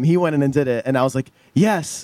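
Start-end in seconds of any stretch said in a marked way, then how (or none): 1.5-2.0 relieved yes. Retold or imitated. Relief after rhythm fixed.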